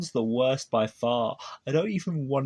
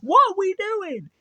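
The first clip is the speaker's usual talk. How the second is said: much higher, louder incredulous outburst; high disbelieving outcry at the clip; outraged disbelief; 5 dB louder than usual. Real, not acted. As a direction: shriek it in disbelief. Outraged reaction to video.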